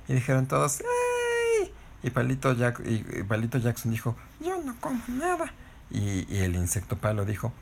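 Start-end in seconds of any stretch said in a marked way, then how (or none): 0.8-1.7 very high squealed cheer; group cheer. Acted. Todos celebran las semillas. 4.4-5.5 high, soft, squeaky stick voice; little stick character. Acted. Voz de Palito Jackson.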